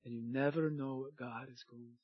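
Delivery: low, soft, reverent delivery; hushed awe. Real, not acted. Preacher telling church God may surprise them, hushed low awe. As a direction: drop it low and quiet, let it sink in. Repeats the line, now hushed.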